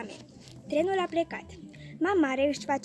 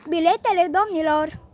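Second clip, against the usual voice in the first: louder official call for tickets; conductor calling; 3 semitones higher, 6 dB louder than usual. A narrator's reading. Conductor asks the passengers for tickets, calling out. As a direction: announce it to the whole carriage.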